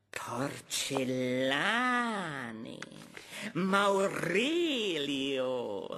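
slow, stretching each syllable; drawn-out name. Acted. Doctor calls out the last name, slowly. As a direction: read the name like it tastes odd.

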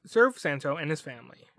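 usual speaking voice

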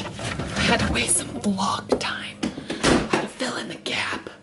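slow, soft whisper; confiding whisper. Pretend. Confessing filler to vlog viewers, slow whisper.

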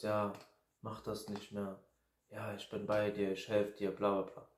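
a hint of soothing a friend; mock comforting.

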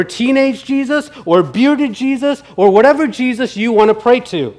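lightly voiced husband defending his choice; Ricky insisting. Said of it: "And then Ricky responds, he says".

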